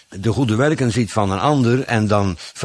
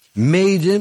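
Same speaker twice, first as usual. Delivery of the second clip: "mede" set apart, higher and drawn out; stress on MEDE; 7 semitones higher than usual. Shown as a demonstration. Preacher pointing out the key word to the congregation, stress on "mede". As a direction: lean firmly on the word "mede". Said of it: "met de nadruk op mede".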